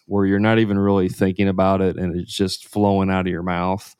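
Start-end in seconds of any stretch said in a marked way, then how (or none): none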